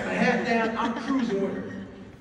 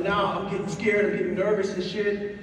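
ordinary delivery

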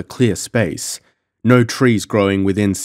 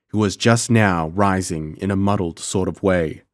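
ordinary delivery, nothing marked

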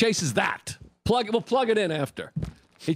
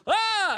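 very high startled yelp; scared scream; 14 semitones higher than usual. Acted. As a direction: yelp like a frightened camper. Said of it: "And the guy goes".

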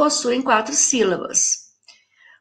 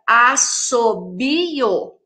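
"Assobio" very slowly split into as-so-bi-o; syllable by syllable; much slower than usual. Shown as a demonstration. Teacher dividing the double S for pupils, syllable by syllable. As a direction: stretch "Assobio" into four separate beats. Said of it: "Um numa sílaba".

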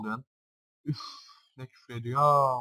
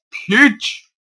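yelled insult at the screen, high and loud; angry shout; 11 semitones higher, 12 dB louder than usual. Real, not acted. Gamer yelling at a Pokemon on screen, furious shout. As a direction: blurt it out in fury.

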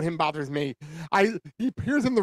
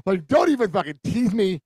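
normal talking voice

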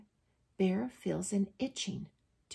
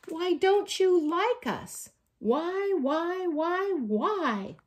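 loud questions climbing into high pleading; 8 semitones higher, 7 dB louder than usual. A narrator's reading. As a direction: press them for an answer, growing higher. Bear pleads into the hole.

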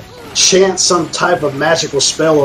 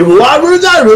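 backwards speech; 14 semitones higher, 6 dB louder, slower than usual. Pretend. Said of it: "It's probably how it sounds".

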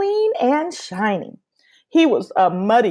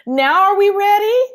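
much higher, loud eager plea; excited little boy itching to go; impatient boy; 13 semitones higher, 7 dB louder than usual. Acted. Pablo begging Abuela to leave, high and loud.

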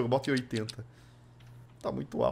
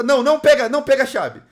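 urgent shout; 8 semitones higher, 9 dB louder than usual. Real, not acted. Urgent shout in a horror game.

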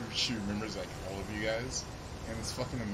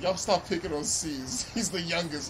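mom voice; 6 semitones higher, slower than usual. Retold or imitated. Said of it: "she's like".

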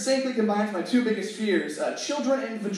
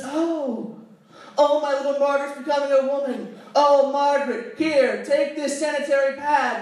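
high, emotional motherly gushing; gushing mom; 5 semitones higher than usual. Acted. Mother gushing at daughter's first period, high. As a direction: gush over her like a proud mom. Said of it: "she looks down and she says".

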